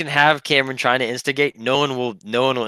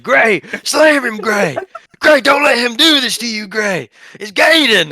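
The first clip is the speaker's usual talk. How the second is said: high, loud egging-on shout; rowdy spectator yelling; crowd yelling; 10 semitones higher, 5 dB louder than usual. Retold or imitated. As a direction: hype your buddy up from the couch.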